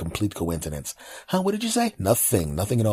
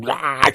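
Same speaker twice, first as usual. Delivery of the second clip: mock pained groan; 6 semitones higher, 5 dB louder than usual. Back demands groan.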